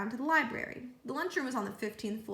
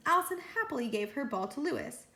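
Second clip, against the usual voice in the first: plain reading voice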